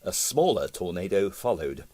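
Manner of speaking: ordinary delivery, nothing marked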